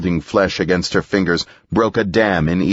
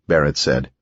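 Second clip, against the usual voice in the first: ordinary delivery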